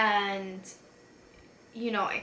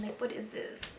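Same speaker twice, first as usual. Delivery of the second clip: whispering; 8 dB quieter than usual. Real, not acted. Looking at her makeup.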